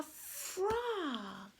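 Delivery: expressive read-aloud with swooping pitch; animated picture-book dialogue. Acted. Reader voices two friends arguing over pretending, animated. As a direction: act out both characters for young children.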